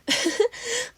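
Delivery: bright rising giggle; high giggle. A narrator's reading. May giggling with Izuku, high giggle. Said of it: "She laughed too".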